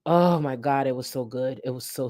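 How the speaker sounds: ordinary talk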